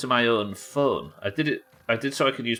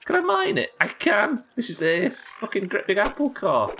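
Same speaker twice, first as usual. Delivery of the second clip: excited; 12 semitones higher, 3 dB louder than usual. Real, not acted. Excited about mining apple core.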